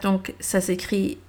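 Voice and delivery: ordinary talk, nothing marked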